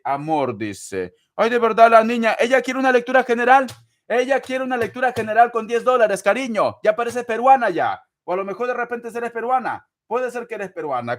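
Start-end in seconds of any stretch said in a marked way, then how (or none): 1.3-6.8 high, loud, fast teasing exclamation; ten-dollar reading, mock-scandalised aside to the viewer; mock outrage. Real, not acted. Mock indignation at a cheap request. 6.8-11.2 high, quick teasing banter; teasing a stingy viewer, higher and fast; playful teasing. Real, not acted. Teasing the viewer about a nationality.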